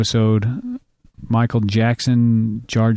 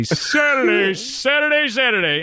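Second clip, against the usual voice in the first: shouting; 10 semitones higher, slower than usual. Retold or imitated. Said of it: "I just look at the date and say".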